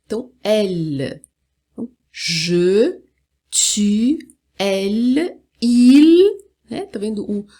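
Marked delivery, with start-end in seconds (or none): 0.4-1.2 "L" as French elle, slow, with no u sound; true final L. Shown as a demonstration. Teacher repeating elle for students, clear final L. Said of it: "o L final não tem som de U". 2.1-6.4 "je, tu, elle, il" spaced out, clear final l; French pronoun model. Shown as a demonstration. Teacher modelling pronouns for learners, careful French sounds. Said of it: "a língua vai até o céu da boca".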